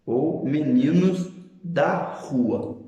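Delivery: slow reading with weight on da; deliberate teaching delivery; slow contrast example. Shown as a demonstration. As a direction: stress da in meninos da rua, slow and clear. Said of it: "Veja, por exemplo".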